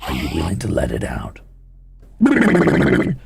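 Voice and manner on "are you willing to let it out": much lower, menacing inner monster; ominous low villain taunt; sinister monster voice. Acted. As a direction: growl it out like a lurking beast. Monster voice after low lines.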